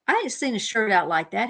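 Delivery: ordinary talk, nothing marked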